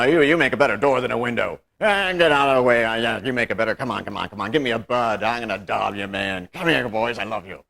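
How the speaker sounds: put-on loud gruff old man easing off into gruff affection; gruff grandpa. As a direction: bark fond orders like a gruff grandfather. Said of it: "Pap was a gruff man".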